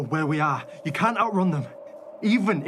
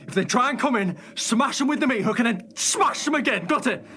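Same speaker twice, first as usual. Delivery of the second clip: urgent; 8 semitones higher, 3 dB louder than usual. Acted. Frantic instructions as wolves approach.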